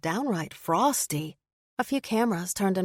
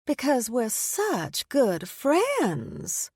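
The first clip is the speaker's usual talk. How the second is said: very slow, high, syrupy Southern drawl; southern accent; 6 semitones higher, much slower than usual. A narrator's reading. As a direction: stretch every word in a sugary Southern accent. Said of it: "emphasizing her southern accent".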